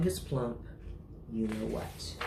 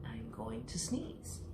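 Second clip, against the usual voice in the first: slow hushed warning; 3 semitones lower, 10 dB quieter, slower than usual. Acted. Elephant warning ominously.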